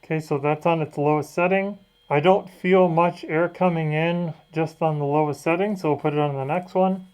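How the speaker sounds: muffled speech through a fan mask; through a face mask. Real, not acted. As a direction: talk from inside a covering mask. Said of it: "I have the mask on my face here".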